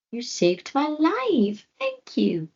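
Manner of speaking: high, slow grateful voice. Acted. Floki thanks the owl, high slow gratitude.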